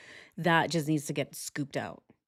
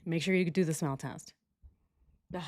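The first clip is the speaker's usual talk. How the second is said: usual speaking voice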